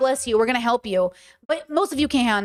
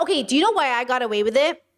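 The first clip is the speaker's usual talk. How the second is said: usual speaking voice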